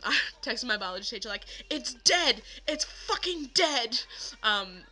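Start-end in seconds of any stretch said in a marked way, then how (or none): 1.7-4.0 higher, louder, slow anguished cry; frantic shout. Retold or imitated. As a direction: yell it out in disbelief. Frantic text to teacher voiced.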